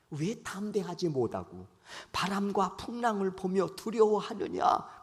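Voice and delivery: ordinary talk, nothing marked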